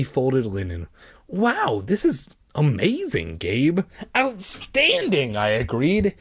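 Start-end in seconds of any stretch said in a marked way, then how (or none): 1.3-3.8 high-pitched acted wonder; impressed cousin, starting high; amazed. Cousin marvels at the discovery. 4.2-5.4 high, drawn-out exclamation; stretched, high-pitched enthusiasm; thrilled agreement. Acted. Boy agrees, thrilled with his find.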